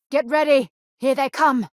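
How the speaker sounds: rally the crew as enemies charge; urgent call to fight. Acted. Combat alert, enemies approaching.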